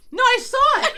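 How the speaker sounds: high, loud protest; wife insisting she saw it; insistent yell. Retold or imitated. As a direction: insist at full volume. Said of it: "'Cause I'm like".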